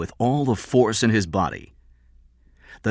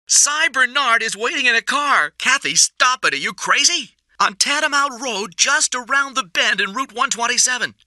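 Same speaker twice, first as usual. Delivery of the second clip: much higher, words tumbling out; pouring out. A narrator's reading. Kathy blurting the hideout to the police, frantic. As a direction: rush every word out before he stops her. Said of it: "The words were pouring out of her mouth".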